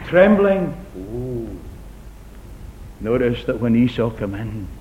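usual speaking voice

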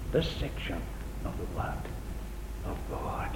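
hushed reverent whisper. Acted. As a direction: drop to a whisper for the Word. Hushed over the scripture.

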